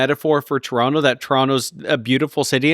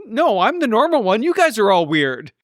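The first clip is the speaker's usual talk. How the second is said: higher-pitched indignant retort; mock indignation; 7 semitones higher than usual. Traveller retorting to Australians, mock indignant. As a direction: protest in a high, put-out voice. Acted retort in accent argument.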